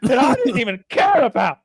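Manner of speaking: loud, high, incredulous shout; hammed-up furious outburst; outraged Englishman. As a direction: shout in appalled disbelief, acted. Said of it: "He's like".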